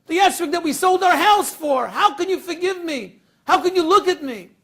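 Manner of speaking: high, loud, pleading and anguished; distraught confession. Acted. Wife begs her husband's forgiveness over broken esrog, anguished loud pleading. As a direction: beg forgiveness through rising panic.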